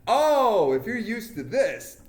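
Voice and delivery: high, loud sudden realization; surprised realization. Retold or imitated. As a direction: gasp as it dawns on you. Realizing at the bus stop.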